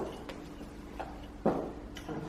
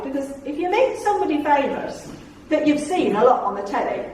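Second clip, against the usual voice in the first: ordinary talk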